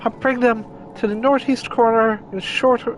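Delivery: very high, chirpy rabbit voice; Loporrit voice. Acted. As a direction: squeak it out like a bossy little bunny. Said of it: "I don't want to do the flippin' Loporrit voice".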